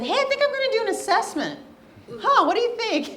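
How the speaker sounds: high, loud, bubbly self-quote; playful retelling of herself. Retold or imitated. Speaker retelling pitching her idea to her intern, high and eager. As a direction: replay her own eager pitch, brightly. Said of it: "when I said".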